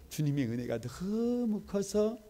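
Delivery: high, slow, voice catching with emotion; choked up. Real, not acted. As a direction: let the grace overwhelm the voice. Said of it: "가슴이 막".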